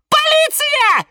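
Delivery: very high, loud shriek. A narrator's reading. Violet plant screaming for the police, shrill shriek. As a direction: squeal it like a frightened little violet. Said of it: "заверещала фиалка Наталка".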